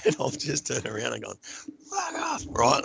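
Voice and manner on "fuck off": high, strained mock scream; retold scream. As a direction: scream it without full volume. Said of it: "I just screamed at her".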